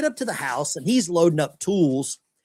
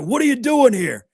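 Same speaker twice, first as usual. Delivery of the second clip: put-on louder, higher, indignant old rocker; indignant old man; 8 semitones higher, 6 dB louder than usual. Old rocker challenging the American in his driveway, indignant. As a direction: bark it like a grumpy old Brit.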